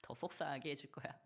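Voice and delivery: a hint of a sulking child; child's sulky thought. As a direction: plot spite like a stubborn kid. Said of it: "애들은 속으로 그렇게 생각한다니까요".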